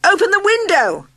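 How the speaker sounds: high, loud desperate yell; panicked shout. A narrator's reading. Narrator shouting to be passed out, panicked. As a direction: shout for your life. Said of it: "I shouted".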